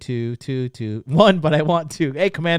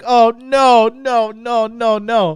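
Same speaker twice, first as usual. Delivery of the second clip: high, loud string of panicked denials; hammed-up dread; freaking out; 9 semitones higher, 9 dB louder, much slower than usual. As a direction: wail it in mock panic. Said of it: "for me to freak out".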